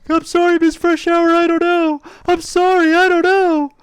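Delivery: put-on high, pleading scared child voice. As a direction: beg like a terrified kid.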